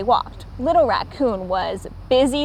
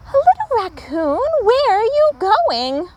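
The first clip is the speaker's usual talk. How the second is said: mother calling; 10 semitones higher than usual. A narrator's reading.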